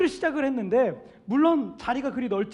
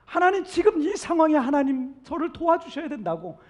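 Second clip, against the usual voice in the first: higher-pitched, pleading cry in a preacher's retelling; acted desperate prayer; crying out to God; 5 semitones higher, 4 dB louder than usual. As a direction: plead urgently to God, voice lifted. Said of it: "울부짖으면서 지금을 찾고 있다".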